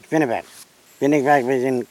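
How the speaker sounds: ordinary delivery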